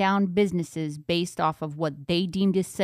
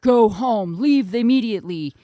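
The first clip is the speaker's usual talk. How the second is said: barking police orders; 4 semitones higher, 7 dB louder than usual. Retold or imitated. Imitating cops' orders.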